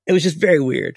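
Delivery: ordinary talk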